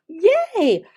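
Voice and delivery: high, bright cheer; cheerful praise. Shown as a demonstration. Teacher cheering a young student, high and bright. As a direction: celebrate the child's answer. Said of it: "That's how you teach it".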